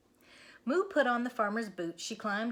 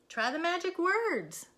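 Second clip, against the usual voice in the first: high, louder; excited suggestion; excited Moo; 10 semitones higher, 5 dB louder than usual. Acted. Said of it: "said Moo".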